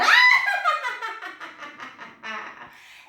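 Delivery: high, loud cackling witch laugh; witch's loud laugh. A narrator's reading. Witch laughs in the stormy wind. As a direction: let out a gleeful witch cackle. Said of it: "The witch laughed aloud".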